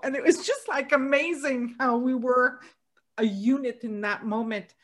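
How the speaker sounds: ordinary delivery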